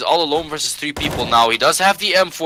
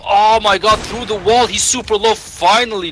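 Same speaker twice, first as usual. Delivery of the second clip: excited; 8 semitones higher, 4 dB louder than usual. Real, not acted. Sudden wallbang kill.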